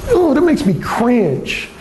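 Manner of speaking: ordinary delivery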